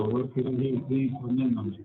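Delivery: ordinary talk, nothing marked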